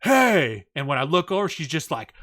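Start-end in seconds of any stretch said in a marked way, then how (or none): 0.0-0.7 loud, high, drawn-out attention-getting call; imitated demanding holler; sudden shout. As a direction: yell it across the room. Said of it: "All I hear is a".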